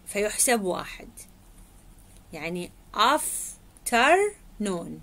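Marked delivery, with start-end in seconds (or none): 2.3-4.9 afternoon spelled out slowly, syllable by syllable. Shown as a demonstration. Teacher showing students how afternoon is pronounced, slow and syllabic. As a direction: break afternoon into its three beats, slowly. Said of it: "بهالشكل تلفظ".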